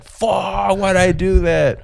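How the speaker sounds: high, drawn-out mock anguish; pissed at himself. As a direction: wail it in hammed-up self-annoyance. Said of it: "I was pissed".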